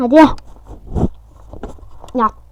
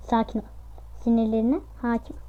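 "sakin ol" and "Sinirlerine hakim ol" said much softer, calming; self-soothing mantra; 2 semitones lower, 12 dB quieter, faster than usual. Shown as a demonstration. Gamer soothing his own anger after dying, soft and calm. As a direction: murmur it to yourself to settle your nerves.

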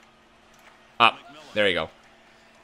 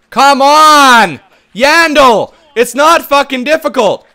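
much higher and louder, fed up; frustrated yell; 11 semitones higher, 6 dB louder, slower than usual. Real, not acted. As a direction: shout at the player who blew the play. Yelling at teammate Yandle.

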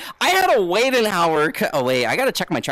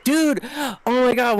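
ordinary talk, nothing marked

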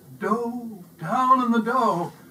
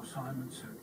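plain reading voice

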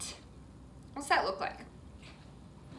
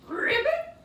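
much higher squeaky ribbit; frog croak; 5 dB louder than usual. Pretend. Teacher doing froggy pose for kids, high ribbit. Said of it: "Let's hop at the same time".

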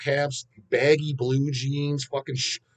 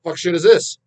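mock outburst; 4 semitones higher, 10 dB louder than usual. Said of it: "you're like".

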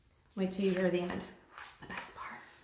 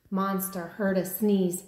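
usual speaking voice

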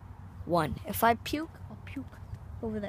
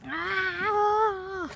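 sudden high yelp; a sharp startled cry; 10 semitones higher than usual. Real, not acted. Startled by the bottle.

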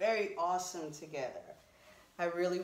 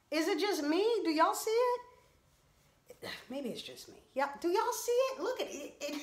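incredulous; 9 semitones higher, 4 dB louder than usual. Real, not acted. Vlogger asks viewers about the unflattering skirt, incredulous. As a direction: plead with the viewers to see it.

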